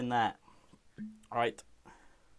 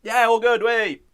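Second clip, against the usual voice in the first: higher, louder strained gasp after a shot; reacting to the shot; 6 semitones higher, 6 dB louder, slower than usual. Real, not acted. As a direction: choke it out as the vodka burns. Burn of the vodka shot.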